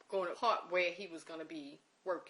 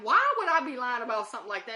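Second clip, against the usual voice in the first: high, loud, fast protest; exasperated protest; 5 semitones higher, 11 dB louder, faster than usual. Retold or imitated. Protesting to the uncle who says she's lying, high fast exasperated. Accused of lying about death.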